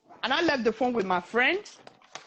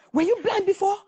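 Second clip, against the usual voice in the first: high, accusing rapid-fire questions; mock an angry man's high-pitched grilling; angry confrontation; 9 semitones higher, faster than usual. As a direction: demand answers in a raised, angry pitch. Acted angry confrontation in retold beating.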